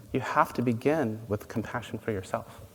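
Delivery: usual speaking voice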